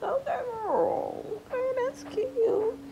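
high, slow, soft sing-song; squeaky fake-cute reply; syrupy mock "that's cute"; mock sweet approval.

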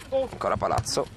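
rising to a shouted goal call; breathless italian goal commentary. Acted. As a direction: build it up and scream gol.

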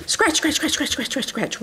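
rapid-fire repeats imitating frantic scratching; real fast scratching. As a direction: rattle it off like scrabbling paws. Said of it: "real fast".

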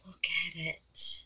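awed whisper. Real, not acted. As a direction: breathe it out in wonder. Admiring the piece in a whisper.

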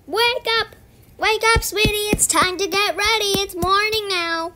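put-on high, bright mom calling a kid awake; chirpy morning wake-up voice; cheery wake-up call. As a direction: sing it out like a parent at the door. Waking a child, parent voice.

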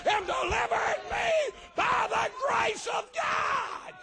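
starts plain, swells into a high shouted prayer; from humble plea to ecstatic preaching shout; reenacted prayer building to shout. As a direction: relive the altar prayer, rising to a holler. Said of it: "it went a little bit like this".

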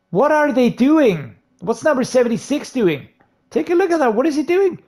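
aghast at the screen, can't believe the play; real gamer outrage, pitch flying up; exasperated disbelief. Real, not acted. Disbelief at teammates' blocking.

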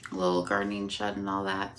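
ordinary delivery

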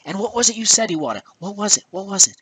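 high-pitched, needling repeated questions; mock interrogation. Real, not acted. Quizzing Iwata on his old words, mock interrogation. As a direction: press for the answer you already know.

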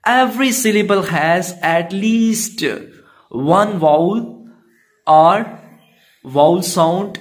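slow, deliberate rule read. Acted. Teacher reading the vowel rule to pupils, slow. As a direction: spell out the rule slowly.

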